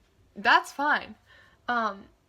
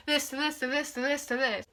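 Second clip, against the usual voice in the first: higher, rattled off in one run; rapid-fire mimicry; 4 semitones higher than usual. Mocking a storyline going lightning fast, rattled off higher. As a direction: mimic a jarring string of jumps.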